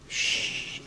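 soft unvoiced shh to quiet them; shushing. Real, not acted. Rabbi hushing students who call out answers, soft shh. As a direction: hush the room.

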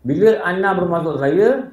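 normal talking voice

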